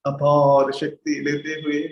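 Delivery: slow, measured meditation guide; guided meditation. Real, not acted. Teacher guiding students to sit at ease with the breath, slow meditation voice. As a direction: lead them into stillness, drawing out words between long silences.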